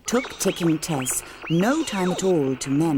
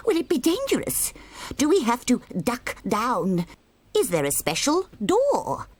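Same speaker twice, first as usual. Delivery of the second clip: higher, fretting duck voice; fussy duck questions; 9 semitones higher than usual. Acted. Dippy Duck asking Ben nervous questions, pitched up. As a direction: worry aloud like a nervous duck.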